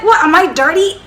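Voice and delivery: high-pitched affronted question; insulted. Retold or imitated. Reacting to woman wiping off her touch, insulted. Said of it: "I was, I was insulted".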